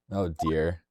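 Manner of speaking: usual speaking voice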